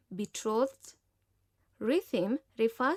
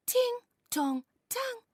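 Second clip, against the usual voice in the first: sing-song guitar sound; 13 semitones higher than usual. Acted. Imitating guitar sound in poem.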